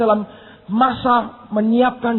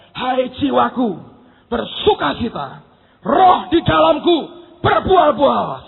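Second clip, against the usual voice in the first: slow, drawn-out cry building louder; rallying shout; 4 dB louder, slower than usual. Retold or imitated. Weary preacher calls on his own soul, building shout. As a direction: call up your spirit, swelling to a shout. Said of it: "Saya teriak begitu".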